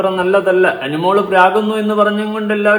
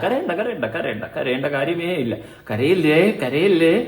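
softer fake consoling coo; mock soothing; 4 dB quieter than usual. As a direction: hush her like a crying baby, sarcastically. Mock soothing the crying mother.

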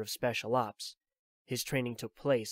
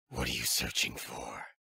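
put-on much lower, a weary old soldier; Snake's voice; 12 semitones lower than usual. Old soldier explains his pursuit to Drebin, low and weary. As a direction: drop into the tired veteran's low voice.

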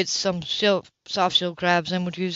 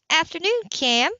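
put-on young woman's voice; 9 semitones higher than usual. Grace voiced, higher.

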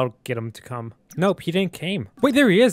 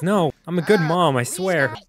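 ordinary delivery

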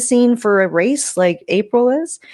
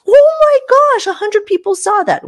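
high, louder thrilled gush; excited squeal; 5 dB louder than usual. Pretend. Creator mocking the view-count rollercoaster, high excited gush. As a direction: gasp it out, thrilled by the views. Imagined creator reacting to post numbers.

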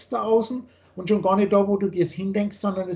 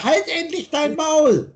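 high, drawn-out yell to shut up; angry shout; 8 semitones higher, 5 dB louder, slower than usual. Acted. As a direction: yell it at the mirror, loud and slow. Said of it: "du schreist den Spiegel an".

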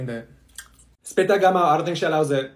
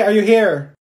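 usual speaking voice

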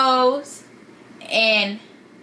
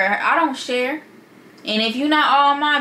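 normal talking voice

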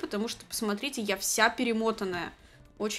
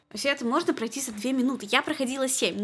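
ordinary talk

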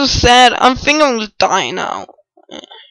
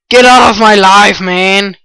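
loud lower shout; louder angry outburst; angry yell; 5 semitones lower, 5 dB louder than usual. Real, not acted. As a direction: yell him away. Yelling at an attacker.

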